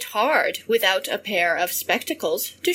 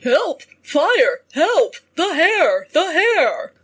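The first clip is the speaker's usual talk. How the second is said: loud, higher-pitched storybook cries; acted cries for help, raised voice; calling out for help; 6 semitones higher, 4 dB louder than usual. A narrator's reading. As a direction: call out in alarm as the fleeing hunter. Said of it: "hears him call out everywhere".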